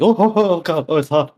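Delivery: high pained yelps; yelping in pain. Retold or imitated. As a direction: yelp as if burned. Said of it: "with a little yelp".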